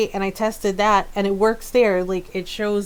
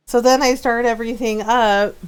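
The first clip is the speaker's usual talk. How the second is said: normal talking voice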